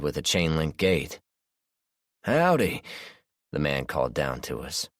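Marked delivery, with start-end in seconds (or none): 2.2-2.8 southern drawl. A narrator's reading. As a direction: call up a friendly Southern hello. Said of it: "the man's southern accent".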